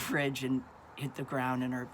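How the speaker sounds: normal talking voice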